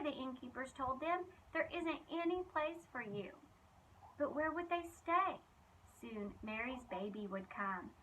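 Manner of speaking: lively, expressive storybook reading; reading to children. Acted. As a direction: tell it like a bedtime Bible story for kids. Reads Bible story to children.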